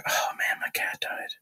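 hushed unvoiced whisper of dread. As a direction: breathe it out, barely audible. Retelling panicked thought in class.

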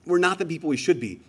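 usual speaking voice

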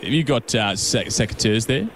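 low, broad Aussie tradie; easygoing Aussie ocker bloke. Pretend. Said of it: "put on a bit of an ocker voice".

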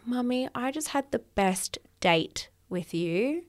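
put-on soft whispered child's voice; little girl whisper. Daughter whispering to mum after their date. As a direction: breathe it like a tiny girl sharing a secret. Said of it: "she goes".

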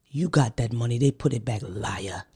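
mock the clerk brushing her off, low and soft; lightly voiced dismissive clerk; imitating the clerk. Clerk accuses her, quoted.

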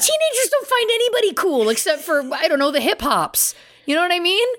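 squeaky, high comic insistence; high, exaggerated reassurance; high-pitched protest. Real, not acted. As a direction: pitch it way up in playful objection. Comic protest to self-deprecating co-host.

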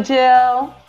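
ordinary talk, nothing marked

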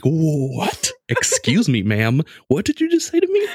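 high, incredulous squeal of disbelief; shocked disbelief. Retold or imitated. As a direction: squeak it out like you can't believe your ears. Sister mentions lady on bed.

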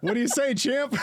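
high, hearty mock pep talk; playful coach-like buddy voice. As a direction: slap him on the back in a high voice. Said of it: "He tells Jean".

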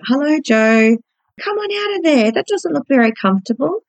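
put-on high, gentle voice coaxing a scared child; speaking gently to a frightened father hiding behind a lamp. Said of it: "I would talk to him like he was a child".

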